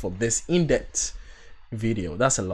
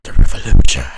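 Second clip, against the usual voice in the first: deep dramatic trailer voice; 11 semitones lower, 12 dB louder than usual. Pretend. Trailer climax line.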